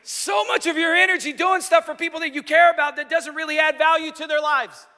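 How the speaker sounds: high loud fervent preaching; preacher at full force; preaching hard. Real, not acted. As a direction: drive the point home from the pulpit. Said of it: "preaching at full force".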